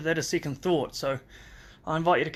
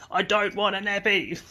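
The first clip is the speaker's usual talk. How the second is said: put-on higher, louder little-boy shout about the nappy; imitating a child's yell; 6 semitones higher, 4 dB louder than usual. Dad retelling his son yelling at mum, imitated higher child's shout. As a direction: play the toddler refusing loudly. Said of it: "and Bo yelled out".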